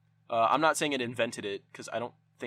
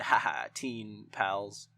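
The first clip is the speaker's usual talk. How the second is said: slow words broken up by a real chuckle; laughing; 2 semitones lower, much slower than usual. Real, not acted. Laughing at shithead teen friends.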